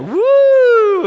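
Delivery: high, loud, stretched-out woo; excited kid whoop. Retold or imitated. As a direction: cheer like a thrilled child on takeoff.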